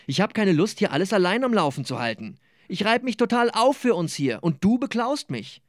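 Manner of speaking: gespielter Vorwurf, deutlich höher gesprochen; wütend. Acted. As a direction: wirf es ihm empört an den Kopf. Streit in der WG. (im Streit mit dem Mitbewohner, aufgebracht und höher)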